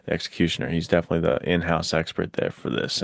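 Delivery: ordinary talk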